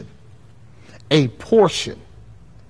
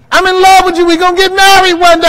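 much higher, loud gushing voice; mock gushing believer; 9 dB louder, faster than usual. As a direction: swoon it out. Lovestruck woman to man.

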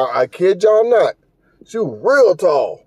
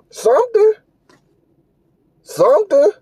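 exasperated; 13 semitones higher than usual. Acted. Telling her to find anything to do, high exasperated word. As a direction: throw it up high in disbelief.

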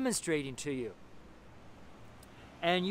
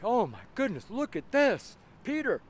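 high, amazed gasp of disbelief; astonished; 5 semitones higher than usual. Retold or imitated. The disciples opening the door to Peter, astonished. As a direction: stare at him as if seeing a ghost. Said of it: "They were astonished".

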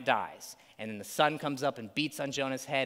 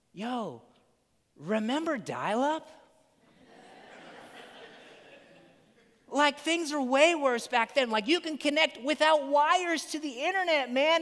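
high, loose dude voice, louder; laid-back barista; 10 semitones higher, 4 dB louder than usual. Retold or imitated. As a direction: play a chill barista brushing off a complaint. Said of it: "that's how I imagine a barista would talk".

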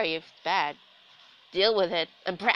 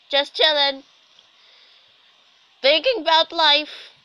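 loud commentary; 7 semitones higher, 12 dB louder than usual. Pretend. Loud musing game commentary.

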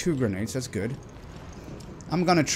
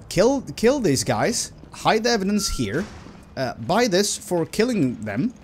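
slow, high, sinister note reading; menacing killer voice; 8 semitones higher, 4 dB louder than usual. Retold or imitated. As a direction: read it like a killer's orders.

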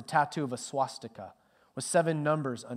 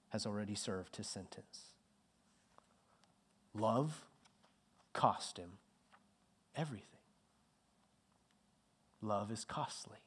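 much softer and lower, hushed; hushed solemn; 5 semitones lower, 10 dB quieter than usual. Real, not acted. Preacher landing the story's point to the church, hushed and solemn. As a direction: let it sink in, hushed.

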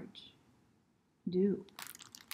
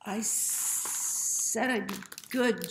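slow regretful thought; 3 semitones higher, 5 dB louder, much slower than usual. A narrator's reading. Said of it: "George thinks".

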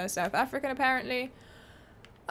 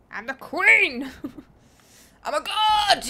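loud, exultant crowing with a laugh; gleeful victory shout; triumphant boast; 4 semitones higher, 9 dB louder, slower than usual. Real, not acted. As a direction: crow it loudly, drawing out the last word. Boasting mid-game.

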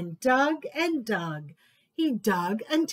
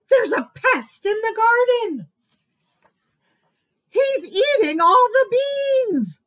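much higher, loud alarmed cry; 11 semitones higher, 9 dB louder than usual. Acted. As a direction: raise the alarm about the pest. Alarmed garden announcement.

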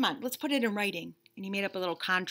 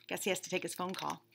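plain reading voice

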